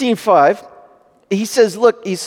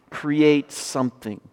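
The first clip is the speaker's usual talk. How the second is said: ordinary talk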